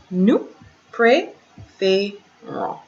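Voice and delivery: "Nous préférons" slowed right down, with gaps; slow, separated teaching pronunciation; syllable by syllable. Shown as a demonstration. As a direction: model "Nous préférons" one syllable at a time. Slow syllable model for learners.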